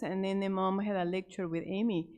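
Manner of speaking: usual speaking voice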